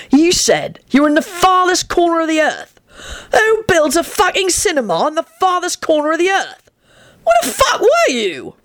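high, mounting incredulous exasperation; impatient. Acted. Sirius challenges the farthest corner claim, mounting exasperation. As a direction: build to an exasperated high cry. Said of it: "Sirius said impatiently".

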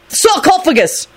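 SARCOPHAGUS cried high and slow, falling at the end; exclaimed incantation. A narrator's reading. Lupin trying a spell on the desk, exclaimed incantation. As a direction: call out Sarcophagus as a drawn-out spell.

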